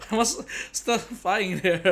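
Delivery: slow, laughing through the words; amused laugh at own mistake. Real, not acted. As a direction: giggle while admitting the goof. Laughing at own game mishap.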